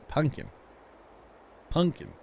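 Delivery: "pumpkin" with an NG sound before the K; dialect pumpkin. Shown as a demonstration. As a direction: say "pumpkin" like "punkin", dropping the middle P. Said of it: "I got yelled at for that one".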